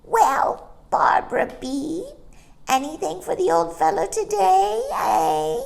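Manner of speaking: high coaxing bird voice; butter her up for a crumb; wheedling starling. Acted. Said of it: "in a soft, wheedling voice".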